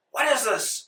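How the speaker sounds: high-pitched acted indignation; mock outrage, voice jumping up; furious. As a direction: demand it like an outraged commander. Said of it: "He's furious".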